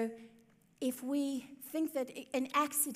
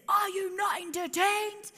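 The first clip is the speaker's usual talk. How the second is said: hammed-up, higher arena challenge; Gladiator quote; 9 semitones higher than usual. Preacher quoting the Gladiator film to her congregation, mock arena shout. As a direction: fling it out like a gladiator to the crowd. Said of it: "is it Gladiators where he says".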